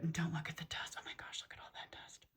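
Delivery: embarrassed whisper, almost off mic; whispered aside. Real, not acted. Crafter ashamed of the dust on her desk, whispering. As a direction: hush it as if viewers shouldn't notice.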